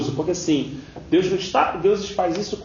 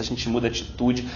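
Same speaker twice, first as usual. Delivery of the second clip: ordinary talk, nothing marked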